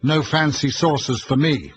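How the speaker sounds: a firm, loud refusal. A narrator's reading. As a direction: put your foot down. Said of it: "Arthur said firmly".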